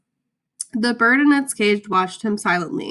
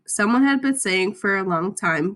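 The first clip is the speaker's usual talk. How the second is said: ordinary delivery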